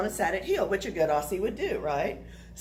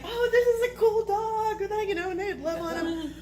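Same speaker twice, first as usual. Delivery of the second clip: high-pitched delighted gushing; imitating a smitten dog person, pitched way up; gushing dog lover; 11 semitones higher than usual. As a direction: coo over a dog in mock delight. Acting delighted dog people.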